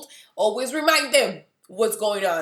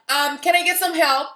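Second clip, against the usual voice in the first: much higher and louder entitled call across the store; a rude customer yelling for help; mock demanding shout; 9 semitones higher, 9 dB louder than usual. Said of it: "to scream back and be like".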